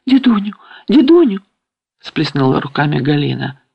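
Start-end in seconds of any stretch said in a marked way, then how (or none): 0.1-1.4 high, rising cry of alarm; alarmed plea. A narrator's reading. Галина clasps her hands and calls grandfather, alarmed. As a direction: call out urgently, pitch climbing.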